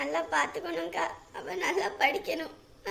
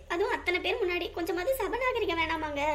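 normal talking voice

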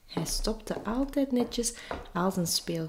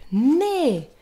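high, loud shouted no; loud, clear shout; 7 semitones higher, 6 dB louder than usual. A narrator's reading. Little Finn refusing his father, shouting. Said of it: "kleine Finn riep".

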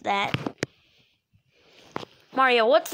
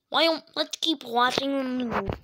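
ordinary talk, nothing marked

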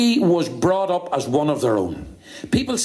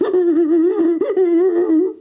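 loud, high monkey hoots; monkey hooting; 12 semitones higher, 5 dB louder than usual. Acted. Monkey asked to think back, loud high hooting. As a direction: hoot in a fast high run. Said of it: "Now, Mucky".

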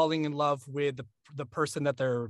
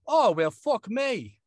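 higher, louder, drawn-out British accent; 7 semitones higher, 6 dB louder, much slower than usual. Shown as a demonstration. Narrator correcting himself for a British character, British accent. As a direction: stretch it out in a put-on British accent. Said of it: "this character is British".

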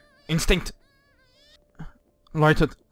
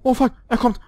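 high, loud panicked cry; 11 semitones higher, 5 dB louder than usual. Real, not acted. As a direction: panic as the monster comes. Panicking as monster comes.

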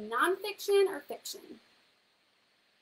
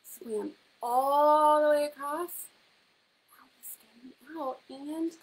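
building suspense; 6 dB louder than usual. Real, not acted. Teacher builds suspense over the picture.

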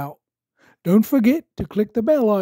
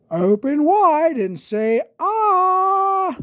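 Doctor Brown Bear voice; 12 semitones higher, 4 dB louder, much slower than usual. Acted.

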